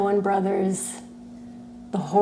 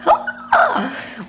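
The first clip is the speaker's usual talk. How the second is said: high, sharp adoring gasp; delighted gasp. Real, not acted. Streamer seeing Pi on the mat, delighted gasp. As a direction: gasp high at something adorable. Sees her pet on the mat.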